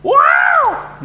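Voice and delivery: sudden very high, loud burst; angry shout. Shown as a demonstration. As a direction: blast it out like a furious temper. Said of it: "Suaranya jadi gede, keras". (teriakan marah yang meledak)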